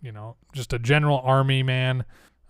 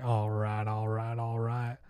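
Matthew McConaughey; 2 semitones lower, 9 dB quieter, slower than usual. Acted. Player showing too much drawl, soft McConaughey impression. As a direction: murmur it as a laid-back Southern catchphrase. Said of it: "Not like the Matthew McConaughey".

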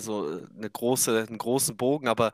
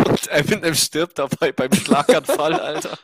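high, loud bursting laughter; can't stop laughing mid-sentence; laughing fit; 7 semitones higher, 6 dB louder than usual. Real, not acted. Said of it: "Jetzt so einen schönen Lachkrampf". (hysterischer Lachkrampf)

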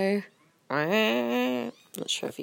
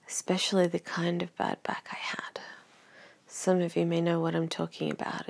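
fast soft whisper; 2 semitones lower, 4 dB quieter, faster than usual. Real, not acted. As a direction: whisper it quickly and close. Whispering to listeners.

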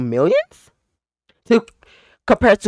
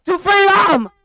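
high, loud, crying-out quote voice; [name]; 3 dB louder than usual. Retold or imitated. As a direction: belt it out like a pop diva. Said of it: "Like [name] said".

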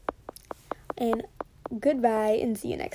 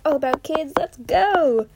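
loud, high hype shout; excited; 4 semitones higher, 7 dB louder than usual. Real, not acted. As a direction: kick off the episode pumped. Hyped episode intro.

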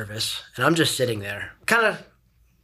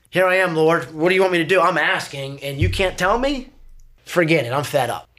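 raised, louder mock-frustrated plea; reenacting an exasperated prayer, pitch lifted; retold exasperation; 5 semitones higher, 5 dB louder than usual. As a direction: complain to God, fed up and demanding answers. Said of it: "and I'm mad".

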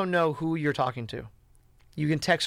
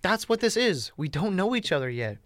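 playful reassurance; 6 semitones higher than usual. Retold or imitated. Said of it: "you just go".

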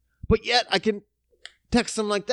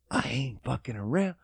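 low quiet repeat; 6 semitones lower, 7 dB quieter than usual. Shown as a demonstration.

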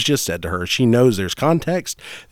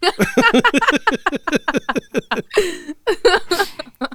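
high laughter burst. Real, not acted. Laughs after joke about dad.

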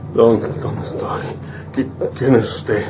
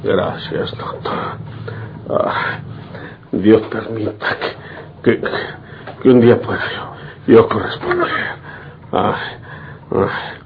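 weak voice; 4 semitones lower than usual. Acted. Weak patient thanks the doctor.

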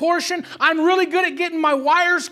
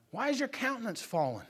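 soft, much softer, caring inquiry; gentle question; 5 semitones lower, 15 dB quieter than usual. Acted. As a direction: ask it tenderly, no blame. The Lord gently questions him.

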